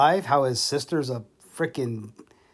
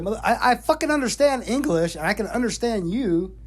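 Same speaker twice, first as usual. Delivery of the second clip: higher, louder exasperated protest; mock-offended higher retort; indignant outburst; 7 semitones higher, 3 dB louder than usual. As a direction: snap back like you're insulted.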